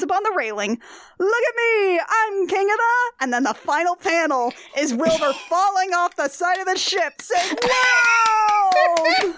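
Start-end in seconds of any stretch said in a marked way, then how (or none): 1.2-3.1 put-on giddy drunk boast. Drunk Wilbur on the railing. 7.6-9.3 high loud startled whoa; falling yelp. Retold or imitated. Wilbur falling off ship.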